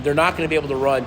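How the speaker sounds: ordinary talk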